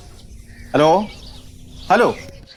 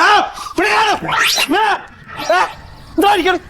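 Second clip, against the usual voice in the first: sudden shout; 13 semitones higher than usual. Acted. Father suddenly yells out in alarm during the phone call.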